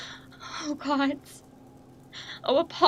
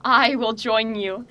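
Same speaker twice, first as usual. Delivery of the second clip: normal talking voice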